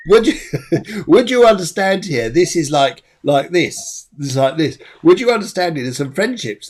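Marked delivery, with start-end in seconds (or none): 0.1-2.1 asked through laughter, higher and louder; laughing. Real, not acted. Medium laughing as she asks the sitter. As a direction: let the laugh carry the question. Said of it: "but I've got a laugh".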